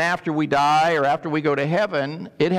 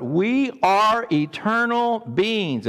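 slow emphatic preaching; 4 semitones higher, slower than usual. Acted. Repeats the slow emphatic line.

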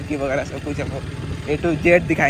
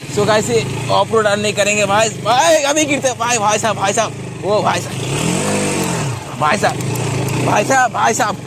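alarmed shouting; 7 semitones higher, 5 dB louder than usual. Real, not acted. Bike about to fall off-road.

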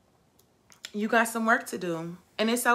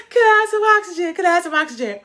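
high, loud, rushed begging for air; a drowning diver pleading with a buddy; gasping voice of someone running out of air; desperate plea; 9 semitones higher, 7 dB louder, faster than usual. Acted.

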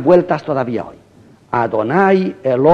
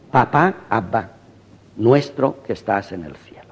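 tender hush; 5 semitones lower, 5 dB quieter than usual. Acted. Quoting Jesus' tender prayer.

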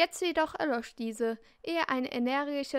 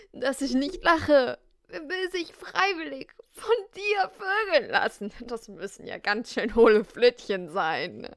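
scornful laughing mockery; 4 dB louder than usual. Mocking the boy's claim.

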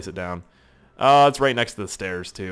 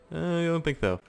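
quick higher dismissive no; higher doubt; 6 semitones higher, 4 dB quieter than usual. Shown as a demonstration. Gamer repeating his doubt, higher and brisk. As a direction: toss it off lightly up high. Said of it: "But I don't think so".

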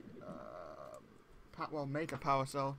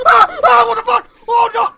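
shrieking far higher and much louder in real panic; real fright screams; 28 dB louder than usual. Real, not acted. Player startled by a leech, real panicked screaming. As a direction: scream as the leech jumps you. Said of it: "that wasn't a fake reaction".

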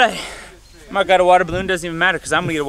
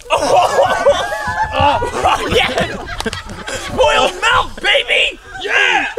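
gloating cheer; 14 semitones higher, much slower than usual. Real, not acted. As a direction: whoop in triumph. Gloating after hitting Mark.

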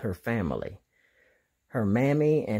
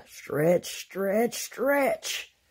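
each stretch climbing higher; stretching call; 8 semitones higher, much slower than usual. Acted. Diego leading the friends in stretching, each word climbing higher. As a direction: reach up higher with every word.

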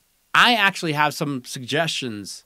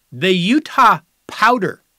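drawn out and louder, "Utah" stressed, falling at the end; slow name announcement; 3 semitones higher, 7 dB louder, slower than usual. Acted. Host announcing a proposed team name, slow and loud. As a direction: present "The Utah Powder" like a grand reveal. Reading out candidate team names.

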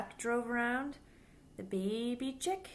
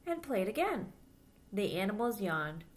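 put-on lively storybook voice for little kids; reading to children; 3 semitones higher than usual. A narrator's reading. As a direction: read it aloud like storytime for children. Reads picture book to children.

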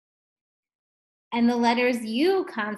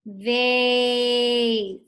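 VASE held long and loud on a level note; said slowly; 5 dB louder than usual. Shown as a demonstration. Teacher telling pupils to say it slow, VASE drawn out. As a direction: stretch out the vowel in VASE. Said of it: "Say it slow".

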